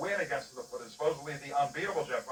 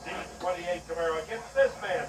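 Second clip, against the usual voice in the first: high, loud drag-race commentary; live play-by-play; 7 semitones higher, 4 dB louder than usual. Real, not acted. Announcer to viewers on Super Stock semifinal, live play-by-play. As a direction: call the race with punch and drive.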